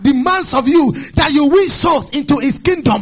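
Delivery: driving, rhythmic sermon delivery; full-force preaching. Real, not acted. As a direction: preach it loud and insistent, hammering each repeated condition.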